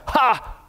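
sharp, high startled "Ha!"; a sudden yelp of surprise; startled cry. Retold or imitated. Preacher acting the demon's startled outburst in synagogue. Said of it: "an interjection of fear or surprise".

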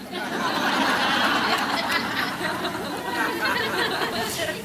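long, high falling laugh. Real, not acted. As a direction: burst out laughing at the joke. Laughing at the sticker joke.

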